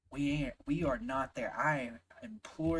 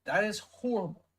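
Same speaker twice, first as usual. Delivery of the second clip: disgusted outburst; 5 semitones higher, 4 dB louder than usual. Real, not acted. Outraged verdict on the mother.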